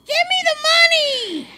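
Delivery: high, squeaky-cheerful imitation of a robber; chipper mock robber. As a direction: demand it far too chirpily, mocking. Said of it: "you're just way too chipper".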